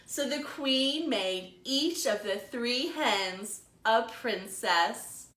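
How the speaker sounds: slow, lively storytime narration; animated picture-book storytelling; reading to children. A narrator's reading. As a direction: read it like a librarian to little kids. Librarian reads picture book aloud.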